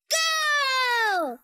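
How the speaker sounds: excited shouted launch cry; calling out. Acted. Child launching a toy car, excited shout. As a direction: draw out the go and let it ring.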